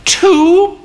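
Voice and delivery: put-on high, sharp old-lady "Two?"; a frail mother's pointed question; old mother asking. A narrator's reading.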